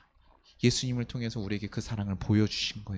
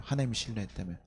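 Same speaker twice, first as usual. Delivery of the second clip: ordinary talk, nothing marked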